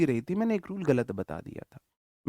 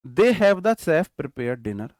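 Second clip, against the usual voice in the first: very slow, loud, word by word; slow dictation; 6 dB louder, much slower than usual. Shown as a demonstration. Teacher dictating an example sentence to his class, very slow and loud. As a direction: dictate each word so students can write it. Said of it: "teacher dictates example sentence".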